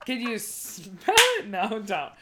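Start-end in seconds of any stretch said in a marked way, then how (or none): none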